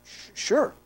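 high, hesitant mock-startled reply; a preacher caught off guard by a strange caller; startled stammer. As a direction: stumble over the word, caught off guard. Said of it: "And I said".

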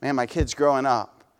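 quiet wistful father; wistful sigh. Retold or imitated. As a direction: let it sink like a father watching his kid grow. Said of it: "You're kinda sad".

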